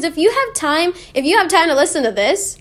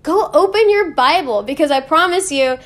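normal talking voice

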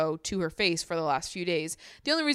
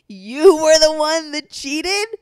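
slow, loud, much higher accusation; outraged; 9 semitones higher, 11 dB louder, slower than usual. Real, not acted. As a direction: call him out with force. Outraged at cheater.

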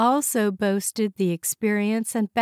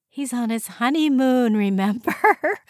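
higher, amused reminder breaking into a laugh; 6 semitones higher, 4 dB louder than usual. Acted. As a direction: tease a colleague with a laugh at the end. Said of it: "laughed Sally".

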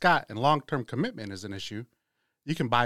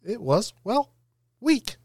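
squeaky; 10 semitones higher, slower than usual. Acted. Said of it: "introduction was weak".

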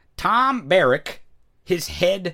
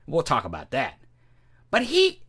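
ordinary talk, nothing marked

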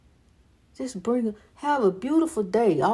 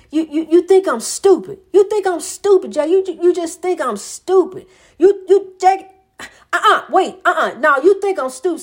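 mock accusation; 5 semitones higher, 5 dB louder than usual. Imitating Brian's accusing tirade.

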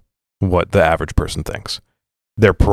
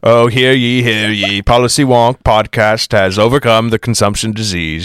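town crier; 3 semitones higher than usual. Pretend. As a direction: announce it like a herald in the square. Mock proclamation of podcast's return.

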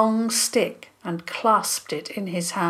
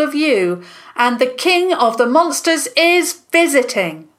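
high, loud public announcement; loud and commanding; 6 semitones higher, 6 dB louder than usual. A narrator's reading. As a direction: shout it to the whole square. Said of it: "a voice, loud and commanding".

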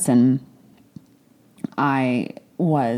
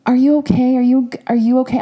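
much higher, louder imitation of sugary concern; mock concerned staff; 10 semitones higher, 5 dB louder than usual. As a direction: parrot their fussing in a high voice. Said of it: "they're like".

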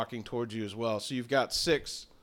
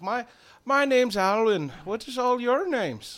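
higher-pitched Irish druid; Irish accent; 8 semitones higher than usual. Acted. Druid introducing himself to the travellers, Irish accent. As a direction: introduce yourself with an Irish lilt. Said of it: "you sound like an Irishman".

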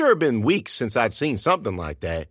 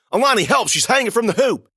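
high, loud call for help; frantic shout; 12 semitones higher, 5 dB louder than usual. A narrator's reading. Narrator yelling to Alani for help, loud and high. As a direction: yell for her friend in panic.

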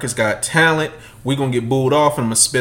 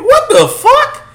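shocked outburst; 9 dB louder, slower than usual. Retold or imitated. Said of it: "I'm like".

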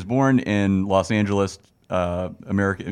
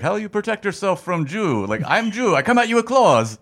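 Ali G impression; 11 semitones higher than usual. Mocking Ali G's self-defense question in a high accent. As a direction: squeal it like a goofy interviewer. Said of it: "he's like".

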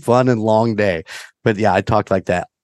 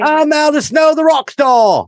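high shouted rock-star voice; Russell Brand impression; 4 dB louder than usual. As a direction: belt it like a cocky rock star. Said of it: "Russell Brand imp".

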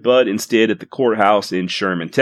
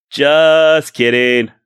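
slow, drawn-out, louder take-back of the joke; just kidding; 3 semitones higher, 5 dB louder, much slower than usual. Real, not acted. Podcaster taking back "go fuck yourself" to YouTube listeners, slow and louder. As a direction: stretch it out to undo the insult.

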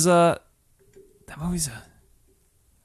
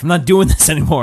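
mock resolute Swayze; 4 semitones higher, 6 dB louder than usual. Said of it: "he's like".

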